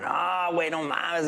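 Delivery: high, sing-songy Chicano drawl; Chicano slang accent. Retold or imitated. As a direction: toss it off like a Chicano buddy. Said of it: "a little bit more Chicano".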